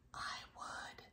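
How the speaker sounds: whispered, small and timid; a little sea star whispering; tiny whisper. A narrator's reading. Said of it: "signaled a little sea star".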